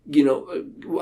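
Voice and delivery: normal talking voice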